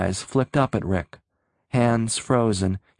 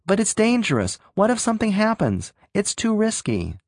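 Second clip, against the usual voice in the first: high, fast, anxious voice; frightened; 10 semitones higher than usual. A narrator's reading. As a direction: plead in rising panic. Frightened youth pleads.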